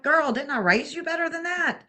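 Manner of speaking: mother disapproving of her daughter's talk, lightly voiced; imitating scolding mom. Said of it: "She'd be like".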